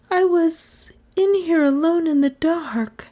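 put-on a high young woman's voice, haunted; slow, frightened girl. A narrator's reading. The girl tells the detective what happened, in a high frightened voice. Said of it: "she began slowly".

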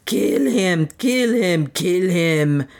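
high frantic screams of kill him; screaming. A narrator's reading. Dwarf screaming at the enemy canoe, frantic high scream. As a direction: shriek it over and over, wild for revenge.

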